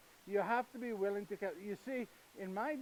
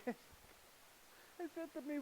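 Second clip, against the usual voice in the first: hushed awe; 8 semitones higher, 11 dB quieter, much slower than usual. Acted.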